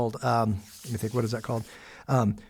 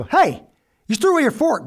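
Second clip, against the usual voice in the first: sharp, much higher, louder bark at his son; like a total jerk; 12 semitones higher, 8 dB louder than usual. Retold or imitated. Dad barks at son for tossing fork, harsh jerk tone. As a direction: snap at the kid over the fork. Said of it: "But I said it like a total jerk".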